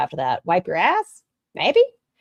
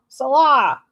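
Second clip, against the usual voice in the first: mating call; 8 semitones higher, 5 dB louder than usual. Pretend. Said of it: "This is a mating call".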